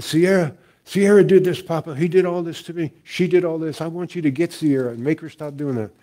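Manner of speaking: high-pitched imitation of an aggrieved little girl; tattling granddaughter.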